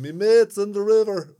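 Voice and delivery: high, drawn-out cry of alarm; hammed-up scream for help, pitched right up; mock scream. Man by the Liffey in a joke, mock scream. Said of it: "and he's screaming".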